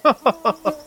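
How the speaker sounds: short high cackle; high laugh. Acted. Butler laughing at Fléchard's jibe, high cackle.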